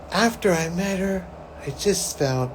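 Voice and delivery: normal talking voice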